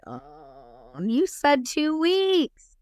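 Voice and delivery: mock pleading, slow and high; whiny protest. Client protests being called in early, high drawn-out whine. Protesting the summons to his office.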